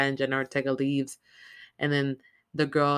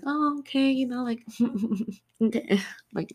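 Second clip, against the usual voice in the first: awkward high brush-off; 9 semitones higher than usual. Retold or imitated. Girl awkwardly brushing grandma off.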